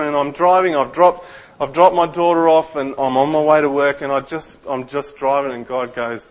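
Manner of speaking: ordinary talk, nothing marked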